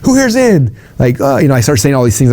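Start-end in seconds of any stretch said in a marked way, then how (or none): 0.0-0.8 much higher, pumped-up speaker voice; hyped stage questions. Shown as a demonstration. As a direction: fire up the crowd from stage. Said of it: "I recorded myself saying them, like".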